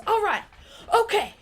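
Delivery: high, loud hype shouts from a song. Retold or imitated. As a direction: imitate a rapper's punchy opening ad-libs. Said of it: "He's like, when it first starts, he goes".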